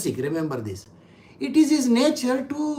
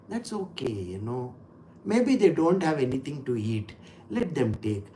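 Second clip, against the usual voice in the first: gentle giver; 7 semitones lower, 7 dB quieter than usual. Retold or imitated. Sattvic person voiced.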